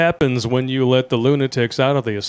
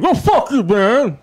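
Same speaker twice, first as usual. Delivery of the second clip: angry customer; 10 semitones higher, 3 dB louder, slower than usual. Retold or imitated. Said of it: "drive-thru scene".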